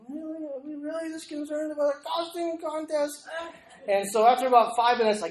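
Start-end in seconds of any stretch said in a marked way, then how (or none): 0.1-3.3 high, thin-patience mock disbelief; comic high-pitched incredulity; acted incredulous thought. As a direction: wonder at the absurdity in a raised pitch.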